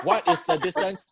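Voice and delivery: laughing through the words; giggling while reading it out. Real, not acted. Laughs while rereading question.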